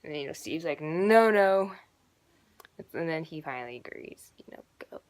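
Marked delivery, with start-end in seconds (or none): 0.8-1.7 loud, drawn-out acted no; firm refusal. Steve refusing to let the kids go, firm loud refusal. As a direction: put your foot down loudly.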